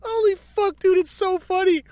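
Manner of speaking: high, squeezed, laugh-weakened voice; real helpless amusement, pitched high; worn out from laughing. Real, not acted. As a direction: say it still cracking up.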